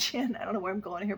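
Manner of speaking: usual speaking voice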